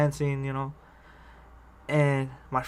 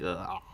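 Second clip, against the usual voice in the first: low, quiet "ugh" imitating messed-up teeth; mock grotesque groan; 8 semitones lower, 9 dB quieter than usual. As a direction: groan it low, like a mangled mouth. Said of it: "her teeth were all like".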